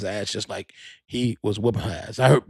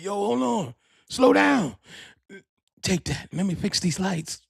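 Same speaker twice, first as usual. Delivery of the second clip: higher, bossy director voice; 6 semitones higher, slower than usual. Retold or imitated. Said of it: "He like".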